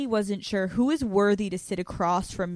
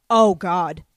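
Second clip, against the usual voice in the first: imitated dismay; 6 dB louder, slower than usual. Acts mother's reaction at door.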